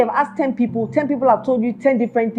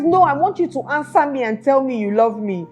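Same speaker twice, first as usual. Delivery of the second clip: put-on insistent girlfriend; 6 semitones higher than usual.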